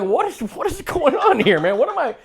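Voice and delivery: high incredulous yelp; exaggerated confused asking; retelling his own confused outburst at the phone, high and baffled. Baffled quote of himself.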